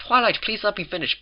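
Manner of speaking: lightly voiced Mrs Cake asking patience; mildly miffed baker. A narrator's reading. Mrs Cake asks Twilight to let her finish, miffed.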